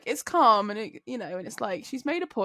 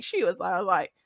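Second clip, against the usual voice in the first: ordinary talk, nothing marked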